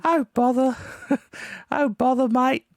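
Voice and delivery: Winnie the Pooh as an Aussie bloke; Australian Pooh. Shown as a demonstration. Asked by co-host to say it in Australian, high mock Aussie Pooh voice. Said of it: "Can you do on, on Australian saying, "Oh, bother"?".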